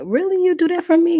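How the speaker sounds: very high, grateful amazement; delighted surprise. Retold or imitated. Student offered a free dental visit by her teacher, delighted surprise. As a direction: imitate being thrilled by a favor.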